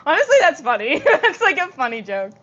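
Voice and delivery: giggling through the words; laughing. Real, not acted. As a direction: laugh as you talk. Laughing at own joke.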